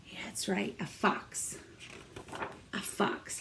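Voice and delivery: soft secret whisper. Real, not acted. Teacher quietly confirming the fox to the kids, whispered.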